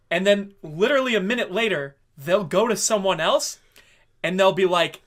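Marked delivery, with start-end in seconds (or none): none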